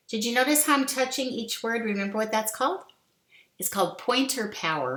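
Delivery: ordinary talk, nothing marked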